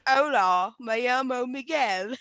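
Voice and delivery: drawn-out, hammed-up British Spanish; exaggerated Brit stretching out Spanish words; mock British-accented Spanish. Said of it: "You're like".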